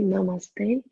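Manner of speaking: normal talking voice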